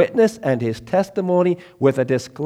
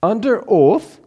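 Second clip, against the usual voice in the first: under oath lifted high and louder; a sudden emphatic lift on under oath; emphatic rise; 8 semitones higher, 6 dB louder, slower than usual. Acted. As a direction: punch under oath upward. Emphatic preaching phrase.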